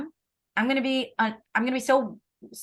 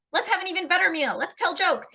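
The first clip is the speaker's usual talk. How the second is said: higher, pushy forced cheer; amped up; 7 semitones higher than usual. Acted. Parent trying to make family dinner magnetic, amped up. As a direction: amp it up, overeager host. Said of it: "so I amp it up".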